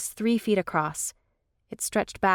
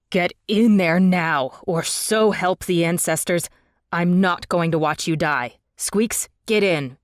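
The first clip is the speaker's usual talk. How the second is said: urgent command; 6 dB louder than usual. A narrator's reading. Forcing friend into tunnel.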